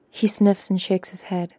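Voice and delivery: ordinary delivery, nothing marked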